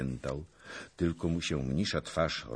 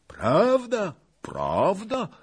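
very high, louder character cry; excited exclamation; 5 dB louder, much slower than usual. A narrator's reading. Longinus agreeing with Zagłoba, exclaiming. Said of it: "wykrzyknął pan Longinus".

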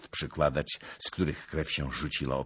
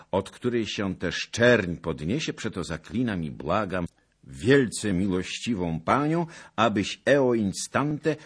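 raised, loud formal letter voice; pompous letter reading; 6 semitones higher, 6 dB louder than usual. Acted. Zagloba reading the letter aloud, pompous and loud. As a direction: declaim the letter grandly.

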